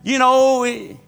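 high, loud revival storytelling; fervent preacher in full flow; preaching at full force. Acted. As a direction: drive the story home to the congregation. Preacher telling Spider-Man story.

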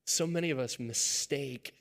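usual speaking voice

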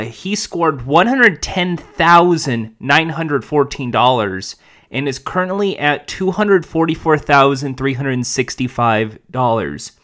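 usual speaking voice

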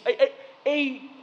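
ordinary talk

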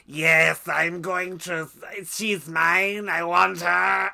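hammed-up possessive outburst, building in volume; the Duke. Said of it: "forty minutes later in this movie".

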